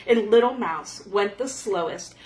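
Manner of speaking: ordinary delivery, nothing marked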